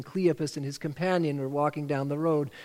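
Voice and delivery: normal talking voice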